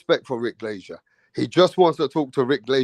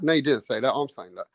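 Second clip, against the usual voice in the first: ordinary talk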